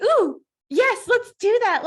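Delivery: high eager excited burst; excited yes. Retold or imitated. Quoting her new eagerness for the program, excited. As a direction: jump at the plan eagerly. Excited quote of own reaction.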